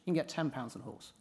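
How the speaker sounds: ordinary delivery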